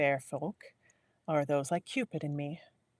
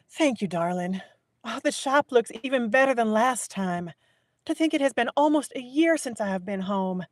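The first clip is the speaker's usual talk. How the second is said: higher, louder Southern belle voice; Southern accent; 6 semitones higher, 5 dB louder than usual. Acted. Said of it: "I'm getting a little Southern".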